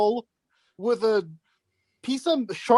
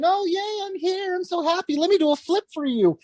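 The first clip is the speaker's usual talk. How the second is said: higher, chirpy mock cheerful wrestler; mock happy guy; 6 semitones higher than usual. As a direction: mimic a goofy overjoyed guy. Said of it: "used to be happy guy".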